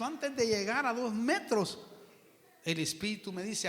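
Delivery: ordinary delivery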